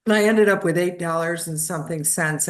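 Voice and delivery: ordinary talk, nothing marked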